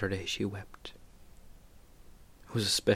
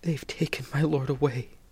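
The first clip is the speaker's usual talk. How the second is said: grieving Mary; 6 semitones higher, 5 dB louder than usual. Acted. Mary's grief at empty tomb.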